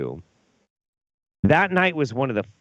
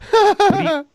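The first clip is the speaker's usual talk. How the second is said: mock disbelief; 11 semitones higher, 6 dB louder than usual. Retold shock at Ben alone.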